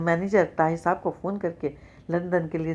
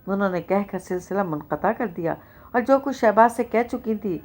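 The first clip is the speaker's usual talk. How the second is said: ordinary delivery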